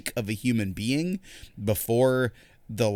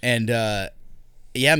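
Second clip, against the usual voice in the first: ordinary delivery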